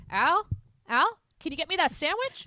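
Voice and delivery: hammed-up Bruce demanding his sandwich; bossy calling out. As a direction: yell across the house for the butler. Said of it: "And Bruce is like".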